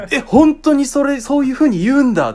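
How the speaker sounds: びっくりした声; retold astonishment. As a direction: relive the shock out loud. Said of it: "俺、もうびっくりしちゃって".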